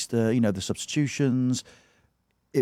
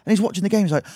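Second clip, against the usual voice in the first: ordinary talk, nothing marked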